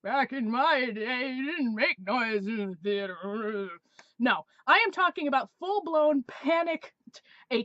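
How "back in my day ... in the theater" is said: put-on slow, creaky old-timer lecturing; old fogey voice. Mocking her own old-fashioned self, slow old-timer voice. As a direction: scold like a grumpy grandparent. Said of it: "something I might have done back in the day".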